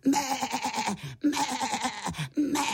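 high goat-like bleats; mečení. A narrator's reading. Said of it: "už beránek slušně mektal". (vysoké kozí mečení)

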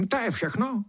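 high, unimpressed beetle voice; chilly, dismissive query; cold question. A narrator's reading. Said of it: "zeptal se chladně".

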